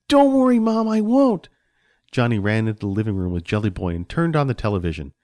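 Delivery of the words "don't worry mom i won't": put-on high, loud child voice; chirpy little boy. Johnny promises Mom he'll be careful, chirpy. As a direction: toss it off like an eager child. Boy breezily reassures mother.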